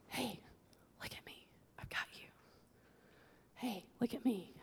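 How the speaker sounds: soft unvoiced whisper as God comforting; tender whisper. God whispering comfort to a hurting listener. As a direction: breathe it gently, close and reassuring.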